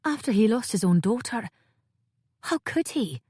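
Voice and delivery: put-on distressed young Cray. A narrator's reading.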